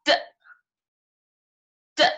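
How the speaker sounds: "T" as a short, isolated t sound; letter sound. Shown as a demonstration. Teacher shows children the sound in catty, "T" as a short t. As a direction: say each "T" as its phonic sound, not the letter name. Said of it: "This is pronounced as".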